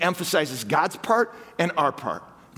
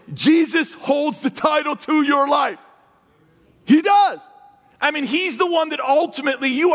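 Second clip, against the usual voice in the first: much higher and louder, building to a cry; higher, louder declaration swelling to its peak; rising preacher shout; 9 semitones higher, 4 dB louder than usual. Real, not acted. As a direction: preach it out with full force. Preacher driving home the point.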